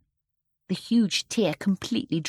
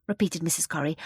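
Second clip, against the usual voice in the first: plain reading voice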